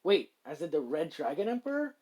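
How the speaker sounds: loud, rising double-take; surprised. Acted. Shizu checking who the red dragon is, surprised. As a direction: burst out with a startled question. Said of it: "tilts her head in surprise".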